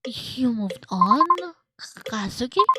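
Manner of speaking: slow, wounded questioning; hurt accusation. Acted. Betrayed lover confronting Kazuki, hurt accusation.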